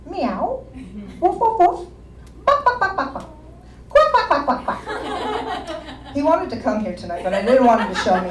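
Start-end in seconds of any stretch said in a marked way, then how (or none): 0.1-6.1 high meow, woof, cluck and quack; animal noises. Retold or imitated. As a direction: imitate barnyard animals like the parrot. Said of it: "he does animal impersonations".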